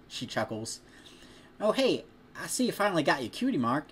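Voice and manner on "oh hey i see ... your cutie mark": put-on higher bright greeting in the girl's voice; cheerful farm girl. Farm girl noticing a friend's new tattoo, higher cheerful voice. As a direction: sound delighted to spot it.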